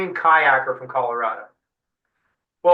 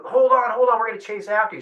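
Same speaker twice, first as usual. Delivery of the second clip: calling out; 6 semitones higher than usual. Retold or imitated. I hear Decker go.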